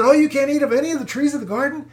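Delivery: lightly voiced devil, rushing the question. Devil questioning the ban on the garden's trees, lightly mocking. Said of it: "but see, the devil said".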